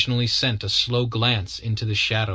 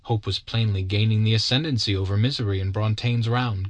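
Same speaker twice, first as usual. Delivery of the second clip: usual speaking voice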